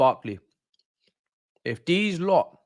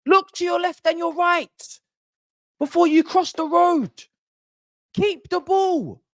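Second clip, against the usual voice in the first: exasperated scolding; 10 semitones higher than usual. Real, not acted. Scolding players like children.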